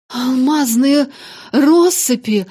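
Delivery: breathless, loud gasping cry; panting shaky eyewitness. Acted. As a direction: gasp it out breathlessly. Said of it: "задыхаясь".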